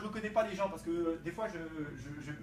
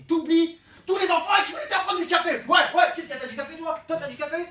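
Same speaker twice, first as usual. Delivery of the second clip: high, loud, wired yell; caffeine-crazed shout; sudden manic shout; 8 semitones higher, 13 dB louder than usual. Acted. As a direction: burst in like a coffee fiend. Caffeine addict bursts back in.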